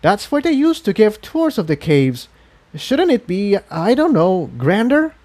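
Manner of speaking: put-on puzzled young dragon asking. Spike questions the voice about the tour building.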